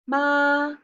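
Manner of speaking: "ma" held high and level; sustained high, flat "ma"; first tone. Shown as a demonstration. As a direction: hold a steady high pitch on "ma". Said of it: "first tone is high and level".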